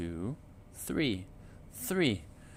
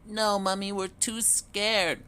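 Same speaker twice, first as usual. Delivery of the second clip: put-on a baby duck too frightened to move; tiny frightened duckling voice; scared duckling; 9 semitones higher, 4 dB louder than usual. A narrator's reading. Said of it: "cried Piddle".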